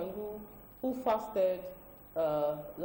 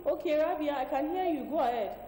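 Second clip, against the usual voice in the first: to someone hard of hearing; 7 semitones higher than usual. Real, not acted. Host answering caller on bad line.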